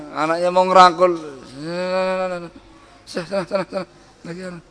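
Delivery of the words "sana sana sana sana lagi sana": soft, fed-up shooing away; weary brush-off. Retold or imitated. Tired father quietly shooing away his child who wants a hug. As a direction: wave the child off wearily.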